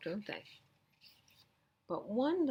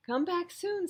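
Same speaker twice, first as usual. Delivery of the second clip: giraffe voice; 12 semitones higher, 7 dB louder than usual. Acted.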